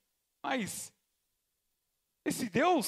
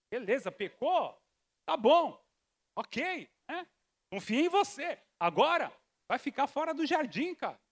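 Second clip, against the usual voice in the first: ordinary delivery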